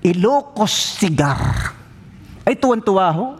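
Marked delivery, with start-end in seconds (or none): none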